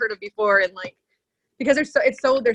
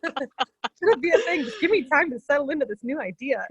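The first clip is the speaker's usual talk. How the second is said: higher-pitched, laughing plea for patience; mock pleading; 5 semitones higher than usual. Asking the inner voice for time, mock pleading.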